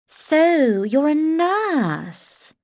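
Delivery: high, loud and slow, a condescending socialite; emphatic posh Portia. A narrator's reading. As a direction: stretch it out, looking down on him. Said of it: "said Portia emphatically".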